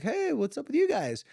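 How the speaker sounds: high, singsong mom hello; friendly greeting. Retold or imitated. Mom greets daughter's vaping friends, high friendly greeting.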